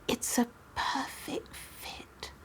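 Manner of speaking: hushed, unvoiced whisper. A narrator's reading. Mother whispering in awe at her daughter's dress. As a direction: breathe it like in a museum. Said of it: "She was whispering".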